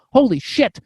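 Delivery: stunned realization. Retold or imitated. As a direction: let the revelation hit you. Retells sudden realization.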